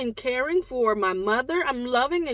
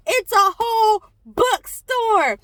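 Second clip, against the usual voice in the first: higher and louder, stressing whole with delight; amazed emphasis; 8 semitones higher, 6 dB louder than usual. Real, not acted. Realizing the table is a whole bookstore, amazed emphasis. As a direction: land on whole like it just hit you. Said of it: "And then I realized it's a whole bookstore".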